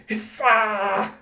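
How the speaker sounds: high, loud mock hiss; comic hissing outburst; cat-like hiss. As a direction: spit out a drawn-out hiss like a hostile cat. Child glaring like a cat.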